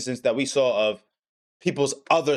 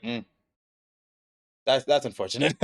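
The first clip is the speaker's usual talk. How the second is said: emotionless deadpan; 3 semitones lower than usual. Retold or imitated. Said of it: "emotionless when he says this shit".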